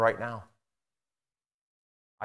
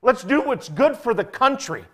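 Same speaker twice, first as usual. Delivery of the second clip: high, loud earnest declaration; impassioned statesman's appeal; ideal statesman; 8 semitones higher, 7 dB louder than usual. Retold or imitated. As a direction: speak up as a selfless statesman. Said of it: "statesmen who will say".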